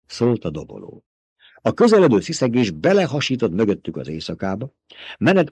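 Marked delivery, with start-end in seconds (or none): none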